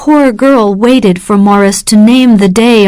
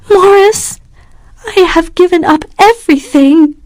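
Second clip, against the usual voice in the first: much higher, distressed plea; crying out; 9 semitones higher than usual. A narrator's reading. Catherine crying out to Morris, distressed. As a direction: cry out in despair. Catherine voiced in read story.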